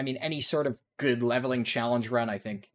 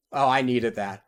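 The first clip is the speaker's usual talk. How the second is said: ordinary delivery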